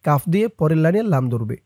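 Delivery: slow, chanted tajweed recitation; Quran recitation. Shown as a demonstration. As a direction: recite it melodically for students to follow. Teacher recites verse again.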